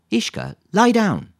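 loud, high, stretched-out order; firm dog command. A narrator's reading. Lisa makes a dog lie down, commanding. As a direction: call it out slowly and firmly to a dog.

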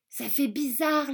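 usual speaking voice

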